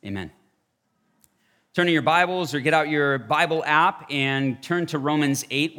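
usual speaking voice

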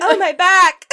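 loud, high yelp of fake agony; mock pained cry. Imitating a man falling over at golf, hammed-up pained yell. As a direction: cry out as if your back just gave out. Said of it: "And falls over".

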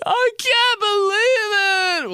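high, slow squealed joy; stretched-out shrieking delight; overjoyed shriek. Retold or imitated. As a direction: scream it like a kid opening presents. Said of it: "crying tears of joy".

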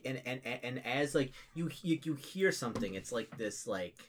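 usual speaking voice

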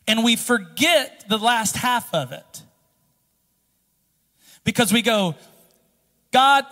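loud, high, fervent preaching; preacher at full cry; full-force preaching. Acted. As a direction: drive it home to the congregation.

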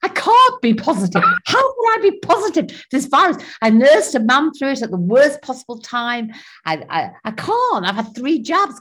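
retold high, loud incredulous protest; disbelieving outburst. Woman recalling reading her positive test result, high disbelieving outburst. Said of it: "And I was like".

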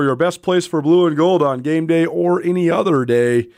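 radio host reading a sponsor spot; live ad read. Acted. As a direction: pitch the bookstore sale to listeners.

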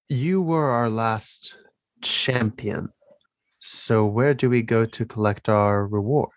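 normal talking voice

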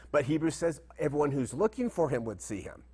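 ordinary talk

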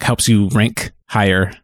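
ordinary delivery

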